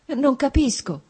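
lightly acted woman's alarm; frightened. A narrator's reading. Fiancée not understanding him, mildly frightened. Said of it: "rispondeva lei spaventata".

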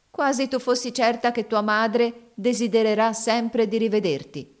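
a little bitter dig; slightly tart. A narrator's reading. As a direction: add it with a touch of sourness. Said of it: "aggiunse un pochino acremente".

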